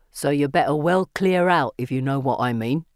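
low, menacing Cockney warning; Cockney accent. A narrator's reading. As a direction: warn them off in low Cockney. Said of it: "using a Cockney accent".

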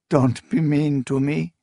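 a hint of tearful murmur; murmured through tears. A narrator's reading. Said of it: "she murmured through tears".

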